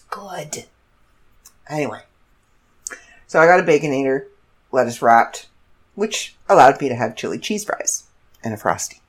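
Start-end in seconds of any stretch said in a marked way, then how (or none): none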